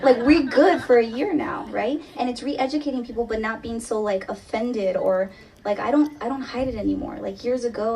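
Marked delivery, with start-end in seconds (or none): none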